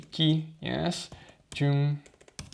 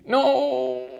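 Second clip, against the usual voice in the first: startled no; 10 semitones higher, 4 dB louder than usual. Real, not acted. Reacts to the wrong answer.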